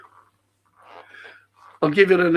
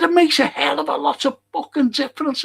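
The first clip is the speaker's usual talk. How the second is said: much higher and louder indignant shout; angry retort; 12 semitones higher, 4 dB louder than usual. Retold or imitated. Arguing with the fella, indignant shout. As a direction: hammer the point home furiously. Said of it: "I fucking fumed".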